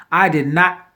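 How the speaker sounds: sudden loud outburst; a real startled yell. Real, not acted. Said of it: "I don't know why I'm yelling".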